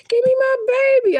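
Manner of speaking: re-enacted high-pitched hysterical scream. Mother fighting husband for her baby, hysterical. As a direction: wail it very high, as if frantic. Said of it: "I'm, like, all hysterical".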